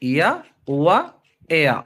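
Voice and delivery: ఇయా, ఉవా, ఏయా stretched slowly with pauses between; demonstrating diphthongs. Shown as a demonstration. Teacher repeating the glides after a slip, slow demonstration. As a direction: sound out ఇయా, ఉవా, ఏయా one at a time. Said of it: "ఇంకోసారి".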